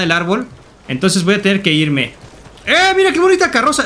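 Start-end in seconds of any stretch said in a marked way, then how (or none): 2.6-3.8 high, excited cry of discovery; delighted exclamation. Pretend. Robber spotting a pretty carriage to take, delighted exclamation. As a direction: exclaim with pitch leaping up.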